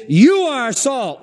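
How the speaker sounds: "You" high and slow, falling hard; stress on you. Shown as a demonstration. Preacher declaring to believers, slow and emphatic. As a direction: declare "You are salt". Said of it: "It is".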